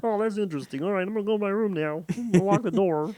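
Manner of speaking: high puppet-like kid, hammed up; Kermit voice. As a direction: play your son escaping in a cartoon voice. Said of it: "Why do you give him a Kermit voice?".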